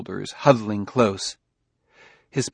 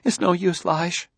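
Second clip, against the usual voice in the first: desperate; 8 semitones higher than usual. A narrator's reading. Jessie desperate.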